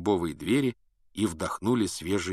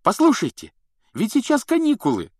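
high, loud, bright exclamation; exclaiming; 8 dB louder than usual. A narrator's reading. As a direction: break the mood cheerfully. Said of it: "воскликнул Рон".

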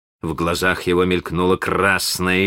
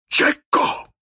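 iron voice. Acted. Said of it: "своим самым железным голосом".

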